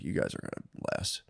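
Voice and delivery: put-on older guy whispering advice to a teen; whispered advice. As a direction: hush it like a grim secret.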